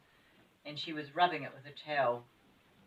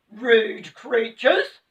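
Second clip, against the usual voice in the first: loud, very slow pixie shout; an angry pixie shouting; 5 semitones higher, 16 dB louder, much slower than usual. Acted. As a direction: bellow at the intruders. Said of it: "shouted the angry little man".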